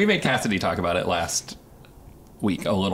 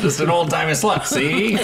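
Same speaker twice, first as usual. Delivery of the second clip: put-on high, loud old-time newsman; 1930s newspaper man voice; 8 semitones higher, 4 dB louder than usual. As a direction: bark it like a 1930s newsie. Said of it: "a nineteen thirties newspaper man voice".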